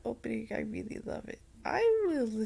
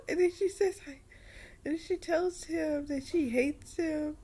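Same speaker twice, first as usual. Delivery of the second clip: ordinary talk, nothing marked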